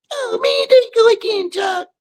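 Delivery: very high, squeaky complaint; put-on listener voice. Preacher voices a listener groaning at him, mock high voice. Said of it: "I gave them these voices".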